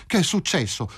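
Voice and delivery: high alarmed question; almost cry out, without real volume; near-shout of alarm. A narrator's reading. Wife fearing for her mother, almost crying out. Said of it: "quasi gridò Filomena alzandosi di scatto".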